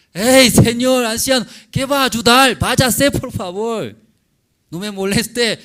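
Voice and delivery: put-on young man shouting at the old stranger to leave him alone; angry shout. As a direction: yell him away, annoyed and fed up.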